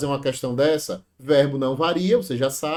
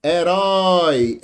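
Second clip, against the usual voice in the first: "Herói" loud and higher, last syllable held long; final-syllable stress; 4 semitones higher, 6 dB louder, much slower than usual. Shown as a demonstration. Teacher proving it's an oxítona, "Herói" drawn out on the end. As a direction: stretch the "rói" of "Herói" emphatically. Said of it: "isso aqui é oxítona".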